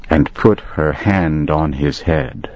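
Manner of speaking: ordinary delivery, nothing marked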